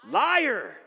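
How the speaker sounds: the congregation calls it back loud. Real, not acted. Congregation shouting the word back at the pastor, loud. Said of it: "you can say it louder".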